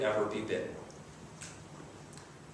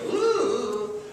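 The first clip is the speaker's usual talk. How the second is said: creeped-out ooh; 8 semitones higher than usual. Retold or imitated. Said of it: "gives me the creeps, and I go".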